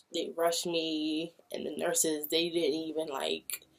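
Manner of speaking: ordinary delivery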